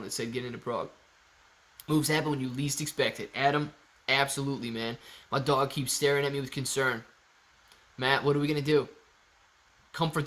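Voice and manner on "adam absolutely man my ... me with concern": usual speaking voice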